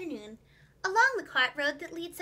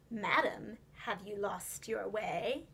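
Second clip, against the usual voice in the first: low, slow, polite fox voice; a sly gentleman lures the duck; smooth fox gentleman; 6 semitones lower, 6 dB quieter, slower than usual. A narrator's reading. Fox gentleman politely greets the lost duck, low and slow.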